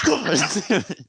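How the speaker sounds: high-pitched mock frustration; exasperated sergeant. Drill sergeant foiled by recruit, high exasperated curse. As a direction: curse like a sergeant at wits' end. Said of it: "She's like".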